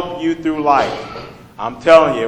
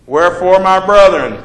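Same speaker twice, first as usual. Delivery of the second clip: preacher reading scripture aloud; 3 semitones higher, 7 dB louder than usual. Acted. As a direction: proclaim the verse to the congregation. Rereads the verse.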